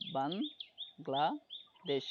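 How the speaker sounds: "বাংলাদেশ" very slowly as ban, gla, desh; syllable by syllable. Shown as a demonstration. Teacher confirming three syllables to student, "বাংলাদেশ" slowly split ban-gla-desh. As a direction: pause after each part of "বাংলাদেশ". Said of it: "কয়টা syllable".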